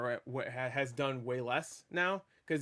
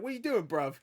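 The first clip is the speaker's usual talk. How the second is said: mock British accent, pitched higher; Peter Parker; 6 semitones higher than usual. Peter to his fallen aunt, British accent. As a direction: slip briefly into playful British. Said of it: "his British accent comes out for that one second".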